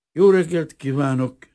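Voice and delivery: high, loud but hesitant greeting; timid greeting. A narrator's reading. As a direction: greet a stranger uncertainly. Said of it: "köszöntötte Vrana Bazil bátortalanul". (bátortalan köszönés)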